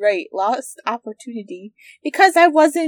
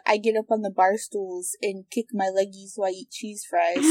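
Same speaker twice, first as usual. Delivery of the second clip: usual speaking voice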